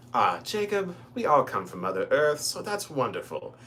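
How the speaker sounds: lightly voiced kindly old professor; a hint of a sweet old man's reassurance; professor's voice. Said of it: "I use this voice, this is literally how he sounds".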